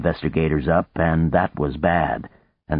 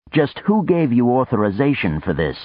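demanding confrontation; 8 semitones higher, 3 dB louder than usual. Acted. Boone confronting intruders.

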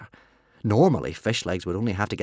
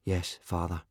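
soft, subdued, dejected reply; very sad; 3 semitones lower, 8 dB quieter than usual. A narrator's reading. Son accepts losing his friend, very sadly. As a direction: give up quietly and sadly. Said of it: "very sadly".

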